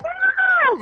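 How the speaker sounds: high mock squeal of excitement; a hammed-up high excited scream; inner scream. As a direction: squeal like you can't contain it. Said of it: "inside, I'm like".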